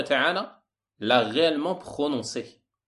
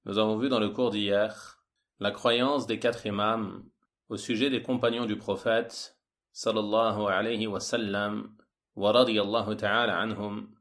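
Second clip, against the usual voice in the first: usual speaking voice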